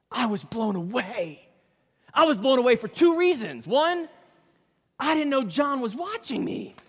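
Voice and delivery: high-pitched, emphatic amazement; blown away. Real, not acted. Preacher tells church he was blown away, pitched high. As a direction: lift the voice high and preach the astonishment.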